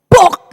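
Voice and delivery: Buk diucapkan keras dan menghentak; bunyi buah jatuh keras. Shown as a demonstration. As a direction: tirukan buah kelapa jatuh berdebum. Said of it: "Suaranya gimana saudara kira-kira". (Buk, keras seperti buah jatuh)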